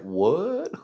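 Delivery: soft, rising disbelief; dumbfounded. Retold or imitated. Friends unable to believe their eyes, dumbfounded. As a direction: barely get out the question.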